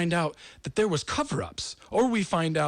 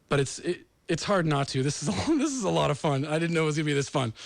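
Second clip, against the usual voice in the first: ordinary delivery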